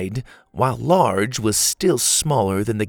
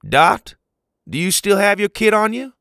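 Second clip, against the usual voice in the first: loud, higher call across the room; 10 semitones higher, 5 dB louder than usual. Acted. Pa calls to Dot across the crowded teepee.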